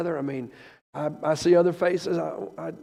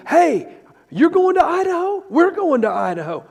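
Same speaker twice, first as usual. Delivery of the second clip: mock excited caller, pitched high; lightly voiced caller bubbling over; caller's excited voice; 13 semitones higher, 9 dB louder than usual. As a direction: play the eager friend on the phone. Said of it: "I start getting calls from people".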